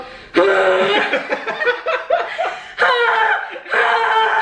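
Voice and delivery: high, drawn-out panicky whoop; mock yelled horse call. Rider trying to slow the horse, mock yelling. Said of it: "now I'm yelling".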